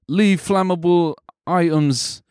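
dole out bad advice in a silly voice; Bevo voice. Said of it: "supposed to be my Bevo voice".

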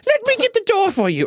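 put-on very high, sugary child voice; creepy polite child. Creepy well-mannered boy greets adults, in a very high voice. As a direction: play the eerie overly polite kid. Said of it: "Children of the Corn creepy".

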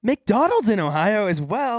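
high, rising disbelief; exasperated disbelief at the news; incredulous. Real, not acted. More fraud alerts.

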